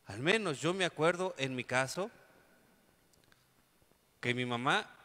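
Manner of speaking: usual speaking voice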